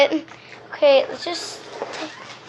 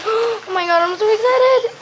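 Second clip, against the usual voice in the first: higher, breathless burst after a gasp; excited; 7 semitones higher than usual. Real, not acted. Slime fan struggling to open her package, excited. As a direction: gush with real, rising excitement.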